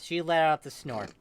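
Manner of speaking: ordinary delivery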